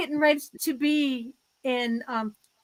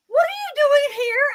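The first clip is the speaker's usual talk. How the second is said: high, choked-up surprise; half-cry it like an overwhelmed friend; teary shock; tearful surprise; 10 semitones higher than usual. Retold or imitated. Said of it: "She was like".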